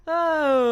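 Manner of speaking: loud high vocal warm-up ah. Shown as a demonstration. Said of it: "something really fun called a warm-up".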